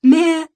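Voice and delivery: high, loud bleat; a goat calling from the road. A narrator's reading.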